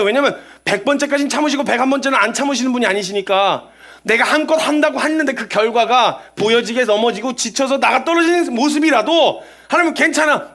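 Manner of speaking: ordinary talk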